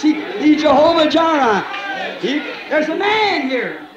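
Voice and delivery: high, shouted, slow preaching over music; preaching at full force. Acted. Preacher proclaims the Holy Spirit to the congregation, shouting high. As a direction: build to a shouting climax.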